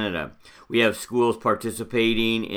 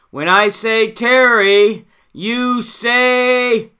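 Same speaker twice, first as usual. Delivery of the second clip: much higher, louder, slower rally call; camp counselor cheer; 10 semitones higher, 6 dB louder, much slower than usual. Acted. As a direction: pump the crowd up even more. Leading a school cheer.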